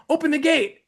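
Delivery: urgent demand. Retold or imitated. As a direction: insist on open the gate. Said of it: "the Holy Ghost was telling me".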